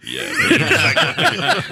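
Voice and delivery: a high, loud, drawn-out whoop of a yeah; hammed-up tipsy party whoop; mock drunk yeah. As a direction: imitate a happily drunk guest cheering. Friend imitates drunk party guest.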